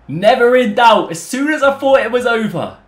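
high, loud jubilation; triumphant shouting. Real, not acted. Gamer to viewers celebrating his equaliser, triumphant shouting. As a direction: crow it out at full excitement.

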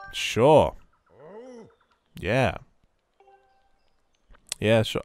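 loud, overdone eager agreement; hammed-up cheerful sure; fake enthusiasm. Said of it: "I'll be as enthusiastic as you are".

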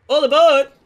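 loud, high train call; mock conductor holler; conductor's call.

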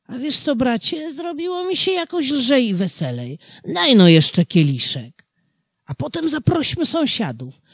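put-on high, merry drunken peasant; tipsy cheerful farmer. A narrator's reading. The drunk farmer to the devil, merry and high. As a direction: sing it out, happily tipsy.

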